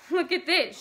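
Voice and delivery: high, loud, shrill call. A narrator's reading. Vicky calling Bert over to the calendar, shrilly. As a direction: pitch it sharply up and loud. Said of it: "she said shrilly".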